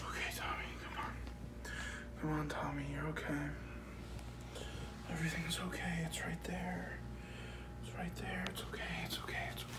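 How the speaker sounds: soft whisper, soothing himself; whispered comfort. Acted. Man whispers to himself to calm his fear, soft whisper. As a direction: hush yourself through the panic.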